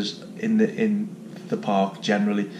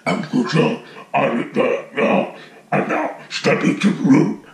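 imitated creepy alien voice, breaking into whispery noise; creepy character voice; 4 semitones higher than usual. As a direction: put on an eerie alien character's voice. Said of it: "the voice is very creepy".

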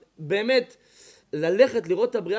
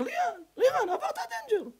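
mocking call; 5 dB quieter than usual. Mocking the man passing danger.

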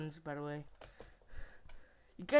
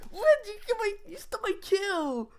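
high indignant complaint; outraged gamer; 11 semitones higher than usual. Real, not acted. Outrage at stolen kill.

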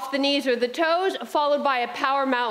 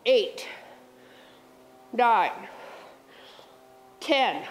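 counting while straining mid-workout; effortful counting during exercise; straining through reps; much slower than usual. Real, not acted. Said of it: "I gotta do mini break".

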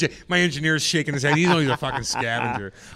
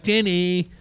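mock mom calling out; 4 semitones higher, 5 dB louder than usual. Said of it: "My mom's like".

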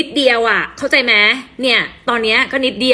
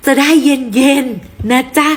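slow and sweet; much slower than usual. Acted. Sweet reply about short hair.

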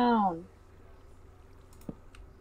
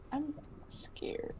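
whispered, barely voiced; hushed frightened whisper; scared whisper; 4 semitones higher, 14 dB quieter than usual. Real, not acted. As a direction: breathe it out in fear. Hears an Enderman in cave.

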